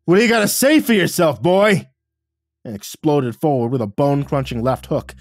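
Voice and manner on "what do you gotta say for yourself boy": put-on high, loud bellow at the boy. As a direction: bellow it with full rage. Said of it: "Dad bellowed".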